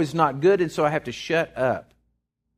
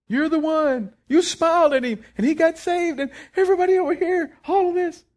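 high-pitched, breathless crowd excitement; acted-out high, excited squeal of recognition; amazed excitement; 12 semitones higher than usual. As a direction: gush at the guest of honor in a high voice. Excited heavenly crowd addressing newcomer.

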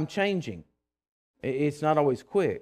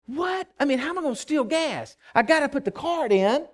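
much higher, outraged what; indignant disbelief; 10 semitones higher than usual. Retold or imitated. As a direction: squeal in disbelief at the rule. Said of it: "And I was like".

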